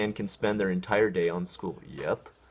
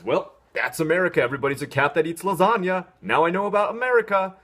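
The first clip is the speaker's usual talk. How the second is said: higher and louder dopey know-it-all voice; naive viewer; 9 semitones higher, 6 dB louder than usual. Pretend. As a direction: play a clueless fan who thinks he gets it. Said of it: "watches a Garfield cartoon and says".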